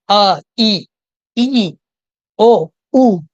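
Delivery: isolated vowels अ, ई, ई, ओ, ऊ each held and sounded out; vowel sound demo. Shown as a demonstration. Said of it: "ये sounds की बात हो रही है".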